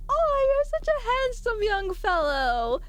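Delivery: very high, slow grandma gush; doting grandma. Acted. Grandma complimenting a younger man, high doting coo. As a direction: coo it like a hammed-up granny. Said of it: "complimenting men like I'm a grandma".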